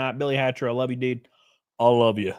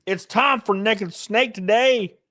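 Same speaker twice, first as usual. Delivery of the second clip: announcer hype; 8 semitones higher, slower than usual. Acted. Repeated announcement, higher.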